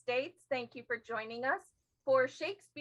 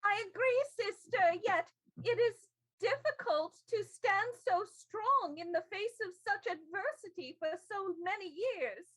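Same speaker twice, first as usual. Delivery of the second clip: high, strained and tired; high weary woman voice; 8 semitones higher than usual. Acted. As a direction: sigh out the long struggle.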